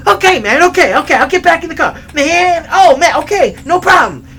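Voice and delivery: high, loud frantic agreeing; imitated panicky surrender. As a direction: give in fast, mock-terrified. Scared man gives in.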